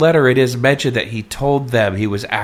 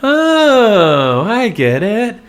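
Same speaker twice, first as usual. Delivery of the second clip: sudden realization; 9 semitones higher, 4 dB louder, much slower than usual. Retold or imitated. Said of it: "and you go".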